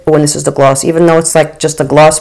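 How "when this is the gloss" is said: normal talking voice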